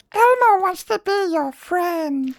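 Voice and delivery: very high, slow, loud puppet falsetto; Elmo. Acted. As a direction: squeak it out like a children's puppet, drawn out. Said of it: "I sound like fucking Elmo?".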